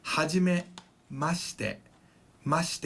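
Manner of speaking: slow "hajimemashite" with shi squeezed against te; "hajimemashite" ending in a compressed "mash-te". Shown as a demonstration. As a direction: say "hajimemashite" slowly, dropping the i before te. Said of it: "compress it to".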